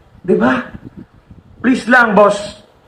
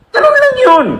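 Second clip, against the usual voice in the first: sudden much higher, louder punchline; emphatic high exclamation; 4 dB louder than usual. Real, not acted. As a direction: snap the line up high. (biglang mataas na diin)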